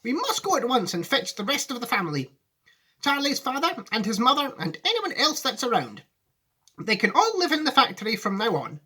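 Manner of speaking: high, eager Wonka voice; a showman in a hurry; brisk Wonka. Acted. Wonka urging the family move, high.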